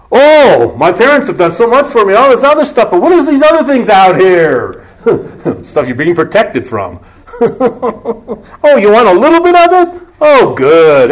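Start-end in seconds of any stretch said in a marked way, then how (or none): 0.1-4.9 put-on whiny teenager. Preacher mocking a typical teenager, whiny and high. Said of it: "typical teenagers". 7.4-11.1 high, teasing tempter's coax; tempting voice. Acted. The adversary luring youth, high teasing coax. Tempter offering forbidden stuff.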